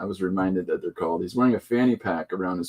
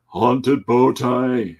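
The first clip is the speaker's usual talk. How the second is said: dramatic announcement; 2 semitones higher, 5 dB louder, much slower than usual. Pretend. Hammy announcing of a comic title.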